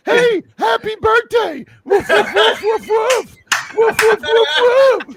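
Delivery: high, loud comic barking voice; barking dog puppet. Acted. Dog puppet wishing man happy birthday, loud barking. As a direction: shout and woof like a toy dog.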